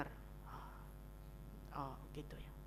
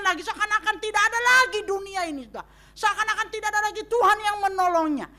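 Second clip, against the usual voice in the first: loud, high, urgent sermon voice; fiery preaching; 8 semitones higher, 7 dB louder than usual. Real, not acted. Preacher crying out against hopeless grief. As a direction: warn the congregation with fervor.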